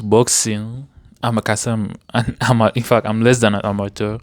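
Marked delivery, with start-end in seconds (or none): none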